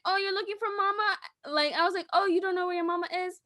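put-on talking to a toddler. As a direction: coo to a lost little child.

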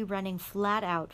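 ordinary delivery

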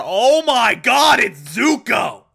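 loud, high, drawn-out shout of disbelief; shocked reveal. Acted. Zhao discovers who is under the mask, shocked shout. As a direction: yell it out slowly in mock shock. Acted shock at plot twist.

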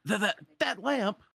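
high, stuttering alarm; shivering stammer. Acted. Iago shivering on the ground before Sora, stammering. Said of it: "Iago on the ground shivering".

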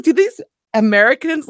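high, loud incredulous question; acted-out astonished scorn; mock disbelief. Voices cat mocking the fake Frenchman.

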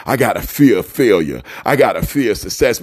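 low mocking voice of a fearful person; mocking quote. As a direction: sneer it in a low put-on voice. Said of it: "talking about".

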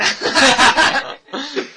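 a big real burst of laughter; loud laugh. Real, not acted. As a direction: crack up at your own joke. Bursts out laughing at own joke.

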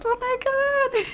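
high, drawn-out mock wail of joy; acted happy crying. Speaker showing how she cries when happy, joyful wail. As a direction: play overwhelmed with happiness, slow and very high. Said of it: "I still cry because".

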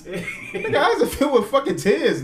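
normal talking voice

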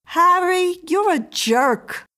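loud, high name, then drawn-out verdict; scornful announcement. A narrator's reading. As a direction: pronounce it on your man, slowly and loudly. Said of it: "announced the woman".

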